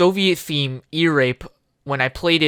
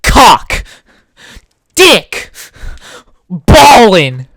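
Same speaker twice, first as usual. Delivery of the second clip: yelling; 2 semitones higher, 11 dB louder, much slower than usual. Acted. Goofing with friends, loud crude yells. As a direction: bark each word out.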